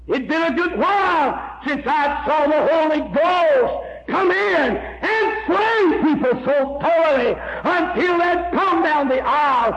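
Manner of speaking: high, drawn-out revival shout; slow, high preacher's cry climbing to the end; preaching at full force. Acted. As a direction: build it like a holler from the pulpit. Sermon climax, shouting over music.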